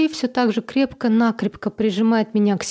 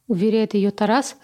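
usual speaking voice

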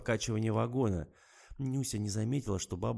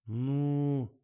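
drawn out; 6 semitones higher, 5 dB louder than usual. A narrator's reading. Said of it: "протянула девица".

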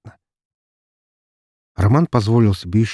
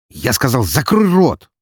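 louder barked order; harsh barking commands; 6 semitones higher, 6 dB louder, slower than usual. Acted. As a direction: bark the command. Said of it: "рявкнул он".

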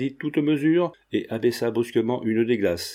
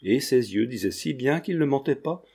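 plain reading voice

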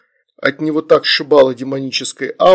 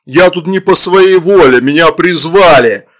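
loud, higher protest, slowing at the end; indignant demon; 3 semitones higher, 8 dB louder than usual. A narrator's reading. Demon defends himself to parents, indignant shout. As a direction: protest innocence at full voice.

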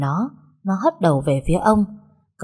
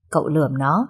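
plain reading voice

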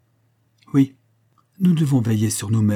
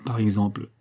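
ordinary delivery

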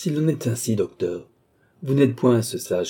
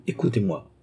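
calm and steady; 2 semitones lower than usual. A narrator's reading. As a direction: settle him down gently. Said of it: "geste qui commandait le calme".

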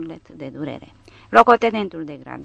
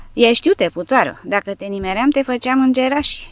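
raised, louder and higher menacing snarl; a drunk soldier jeering at the woman he nearly hit, loud and nasty; mocking drunk threat, pitched up; drunken threat; 6 semitones higher, 5 dB louder than usual. A narrator's reading.

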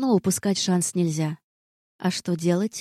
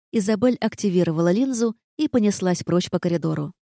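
ordinary delivery

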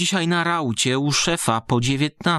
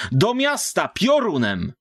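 higher, urgent command to the driver; urgent order; 8 semitones higher than usual. Acted. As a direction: hurry the coachman on. Fleeing man orders the driver. (pilny, głośniejszy rozkaz)

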